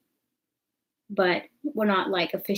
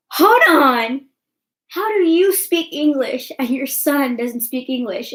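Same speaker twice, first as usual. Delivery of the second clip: much louder and higher, demanding an explanation; incredulous; 6 semitones higher, 8 dB louder than usual. Retold or imitated. Questioning the boyfriend's mom, loud high incredulous disbelief. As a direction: stop everything, this makes no sense. Confronting mom about son's English.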